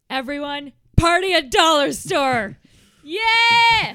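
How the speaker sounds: loud, high party call; excited shout. Acted. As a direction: whoop up the crowd. Calling everyone to party.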